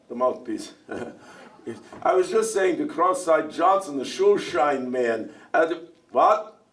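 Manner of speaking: usual speaking voice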